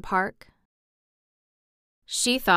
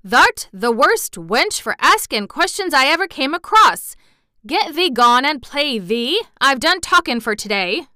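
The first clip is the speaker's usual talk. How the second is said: loud cross scolding in broad Yorkshire, higher; 5 semitones higher, 8 dB louder than usual. A narrator's reading. Gardener crossly sends Mary away to play, loud. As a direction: send her off crossly. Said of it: "he said it so crossly".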